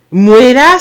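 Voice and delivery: nom lancé plus fort, en montant à la fin; s'écrie «Mouela» en reconnaissant le nom. A narrator's reading. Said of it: "s'écria-t-il". («Mouela» crié avec une montée finale)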